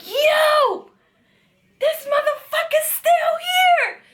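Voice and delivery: high, loud retold scream from upstairs. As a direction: scream it out at the top of her lungs, as she did. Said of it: "She screamed so fucking loud".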